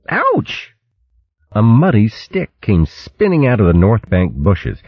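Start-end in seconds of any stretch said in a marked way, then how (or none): 0.1-0.7 very high yelp; startled cry of ouch; sharp yelp of pain. A narrator's reading. Hit by stick.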